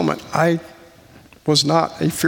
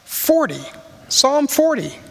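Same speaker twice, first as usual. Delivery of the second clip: raised announcement; 9 semitones higher, slower than usual. Acted. Preacher names the U2 song for the congregation, lifted high. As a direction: lift the title up to the room.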